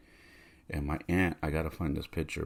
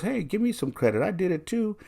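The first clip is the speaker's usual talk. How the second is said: high, loud imitation of a nurse; nurse demanding credit; 7 dB louder than usual. Nurse wants some credit, high loud playful protest. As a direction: protest playfully, pitched well up.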